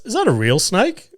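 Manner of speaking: a startled voice that can't believe its eyes; surprised question. Retold or imitated. Coworker at the door spotting a snake, startled and high. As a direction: pitch the question up high in disbelief.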